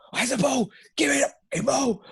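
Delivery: high-pitched mock keeper demanding the ball; mock goalkeeper yelling. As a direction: impersonate a frantic keeper calling for the ball.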